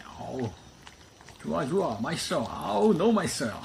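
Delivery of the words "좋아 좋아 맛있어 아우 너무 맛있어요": high, loud, put-on yum; playful pretend relish; mock delight. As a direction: ham up how tasty it is. Mock-eating delight for the dogs.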